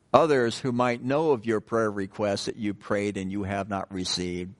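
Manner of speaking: normal talking voice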